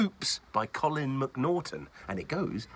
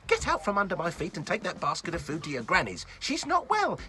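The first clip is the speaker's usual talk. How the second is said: fast, high nagging mother; bossy mum; 7 semitones higher, 3 dB louder than usual. Acted. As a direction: hurry the child off. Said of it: "said Preston's mum".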